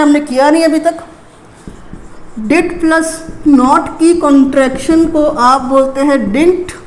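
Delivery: usual speaking voice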